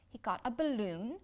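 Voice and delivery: plain reading voice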